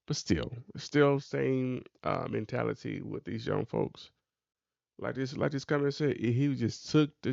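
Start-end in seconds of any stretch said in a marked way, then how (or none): none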